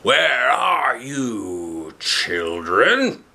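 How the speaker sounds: slow, stretched wolf call. Acted. Wolf calls up to the children, slow and sly. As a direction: draw the call out long and sly. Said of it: "the wolf shouted".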